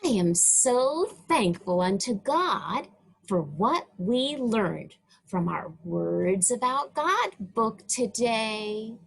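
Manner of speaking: ordinary delivery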